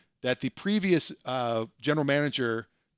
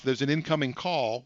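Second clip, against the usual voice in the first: usual speaking voice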